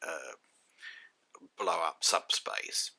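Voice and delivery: ordinary talk, nothing marked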